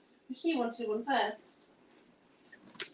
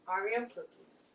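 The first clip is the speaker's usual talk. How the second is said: quiet New York accent; 3 semitones lower, 7 dB quieter than usual. Shown as a demonstration. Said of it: "How else would you pronounce it if you're from New York".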